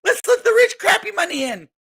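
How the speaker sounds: high-pitched shouting mock voice of eager investors; mock naive investor. Crypto vlogger mocking investors who wanted an ETF, high shrieking voice. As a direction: squeal it like a clueless fan cheering.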